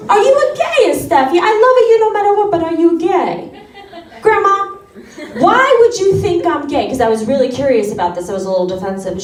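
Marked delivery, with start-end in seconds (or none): 0.1-3.5 insistent grandma. Retold or imitated. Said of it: "she's like". 4.2-6.9 defensive. Retold or imitated. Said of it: "defensive reply to grandma".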